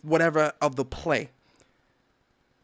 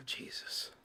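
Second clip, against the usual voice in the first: breathy exhausted whisper; whispered sigh; 19 dB quieter than usual. Real, not acted. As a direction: let it out spent.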